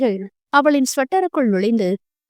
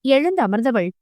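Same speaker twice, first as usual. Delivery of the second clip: usual speaking voice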